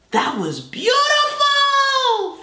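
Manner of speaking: high, slow, laughing rapture; gushing delight. Real, not acted. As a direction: swoon over it, laughing. Gushing over song.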